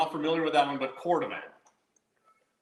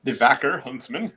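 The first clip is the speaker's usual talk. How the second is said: loud mock Swedish accent; 9 dB louder than usual. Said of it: "That was a Swedish accent".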